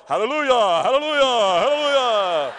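loud, joyful hallelujahs; daddy praising. Retold or imitated. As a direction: shout praise like a proud father. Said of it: "He's there going".

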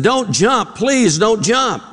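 ordinary delivery

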